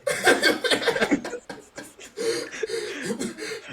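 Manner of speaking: long, high, breathless laughter; real laughing fit. Real, not acted. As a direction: crack up and keep laughing. Cracks up at friend's joke.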